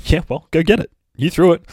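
high, loud voice for the dog; high-pitched cheeky dog retort; dog talking back. Acted. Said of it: "she will look at you and say".

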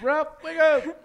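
mock exclamation. Friend echoes the impression loudly.

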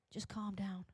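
low, very soft hush; calming. Acted. Society squashing passion, low and hushed. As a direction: quietly shut it down.

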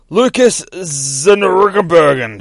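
Zergenbergen said slowly and loudly, stumbling; unsure name. Shown as a demonstration. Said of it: "however you say that last name".

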